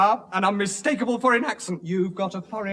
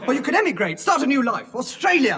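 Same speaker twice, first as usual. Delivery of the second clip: raised, eager pitch; pleading sales push, higher voice; desperate urging; 6 semitones higher, 4 dB louder than usual. Acted. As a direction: talk them into it with mounting desperation. Desperately pushing traveller to emigrate.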